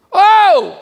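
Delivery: sudden high shouted call; loud call. Retold or imitated. A beggar shouting to Jesus from the roadside, loud call. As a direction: call out sharply to get attention.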